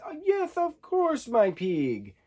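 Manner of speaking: put-on high-pitched villain frog accent; Constantine. Villain frog answers Miss Piggy's marriage question, high put-on accent. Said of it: "In that kind of accent".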